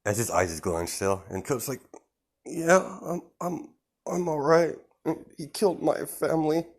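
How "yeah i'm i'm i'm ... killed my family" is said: put-on mildly tearful, high-pitched scared kid; scared crying child. Scared kid tells his rescuer about his family, high and lightly tearful. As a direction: play a frightened kid, voice raised high. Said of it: "he's crying 'cause, he's scared".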